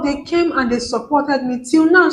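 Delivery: usual speaking voice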